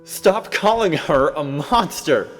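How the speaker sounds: very high, loud, slow scream; anguished cry. Acted. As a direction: scream it in defense of her. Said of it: "I cried".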